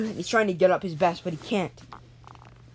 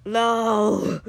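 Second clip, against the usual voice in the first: long, higher-pitched "no" of grief; anguished cry; 4 semitones higher than usual. Acted. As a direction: cry out as a friend is killed. Kenny sees his friend killed.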